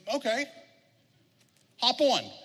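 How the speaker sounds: high-pitched frog character; agreeing frog. Acted. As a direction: give in as the frog, pitched well up. Frog reluctantly agrees in fable.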